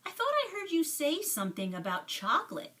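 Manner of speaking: put-on boy at the counter prying, quick and high; curious other boy. A narrator's reading. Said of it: "the boy said".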